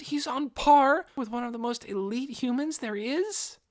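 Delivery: put-on high, awed young man piling up questions; astonished. A narrator's reading. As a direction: voice leaping up as the news sinks in. Inferia astonished by Momon's rank.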